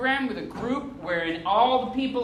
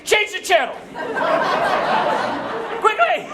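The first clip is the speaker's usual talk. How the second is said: high, urgent yelp; alarmed shout at the TV; panicked TV viewer; 11 semitones higher than usual. Acted. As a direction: yell it in a mock panic. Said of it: "where you're like".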